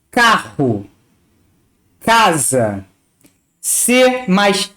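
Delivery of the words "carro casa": slow, clear model words for learners; teacher reading Portuguese examples clearly. Shown as a demonstration. Professora lendo palavras devagar e claramente. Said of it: "C mais A tem som de K".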